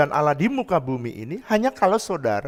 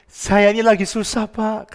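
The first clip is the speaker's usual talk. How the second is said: raised, pleading retelling; troubled neighbour, imitated; 7 semitones higher, 5 dB louder than usual. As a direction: lightly act a man confiding his trouble. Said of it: "Dia bilang ini".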